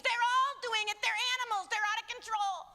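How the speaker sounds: high, scornful parody of the media; hammed-up alarmist voice; mock media voice. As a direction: sneer it like a gossip headline. Mocking the media's claim.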